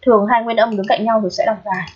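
ordinary talk, nothing marked

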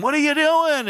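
high, loud taunt from the crowd; jeering mocker. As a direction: sneer it like a heckler.

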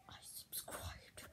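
very soft, voiceless whisper. Real, not acted. Vlogger confiding to Jacksepticeye, whispered. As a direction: whisper it like a secret. Secret message to the YouTuber.

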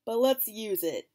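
high, loud mock sarcasm; sarcastic reaction. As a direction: scoff at the idea sarcastically. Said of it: "and I'm like".

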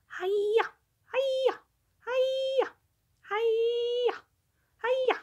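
high, loud karate yells; loud high hi-ya battle cries; karate shouts. A narrator's reading. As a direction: shout each kick like a karate fighter. Karate tiger shouting kicks.

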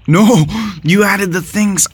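loud high outcry; shout of protest. Real, not acted. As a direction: yell it in disbelief. Outburst at opponent.

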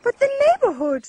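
indignant shout. Acted. Neighbour quarrel.

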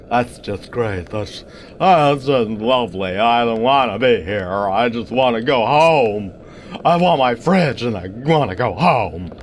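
low, whimpering scaredy-cat whine; Cowardly Lion. Acted. Player lost in the maze, whining as the Cowardly Lion. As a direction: whine like a frightened lion who wants home. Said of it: "that was a pretty decent impression of the Cowardly Lion".

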